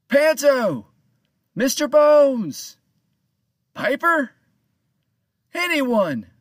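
loud, high call to far-off crew. A narrator's reading. Captain calling out for his missing crew, loud high shouts. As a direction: shout the names, drawing out Bones. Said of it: "he called out to his crew".

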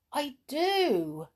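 high-pitched, self-satisfied reply; smug triumph. A narrator's reading. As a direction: savour the win over him. Uncle gloats over beaten crook.

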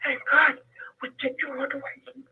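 hoarse, breaking voice fading to a whisper; weak voice that drops to a whisper; voice going in and out. Real, not acted. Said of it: "you have to excuse my voice".

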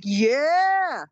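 high, thrilled exclamation; high excited yeah; delighted yeah. Retold or imitated. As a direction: cheer at your young body. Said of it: "I'm like".

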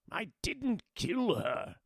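warlord speech. Acted. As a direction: insist through frustration. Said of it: "a tinge of frustration in his voice".

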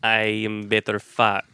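put-on slow, sultry Cuban-Spanish accent, letting words hang; Ana de Armas impression. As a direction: tease him slowly in a Cuban accent. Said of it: "That was a great Ana de Armas impression".

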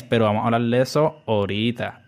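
ordinary talk, nothing marked

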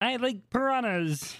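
high, slow, silly take on a friend; Kendall impression. Host teasing a friend to their face, high slow impression. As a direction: stretch it out in a friend's high put-on voice. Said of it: "do an impression of you".

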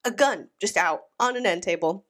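usual speaking voice

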